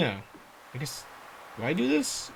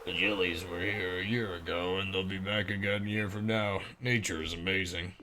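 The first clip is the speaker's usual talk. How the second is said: low character voice; 9 semitones lower than usual. Acted.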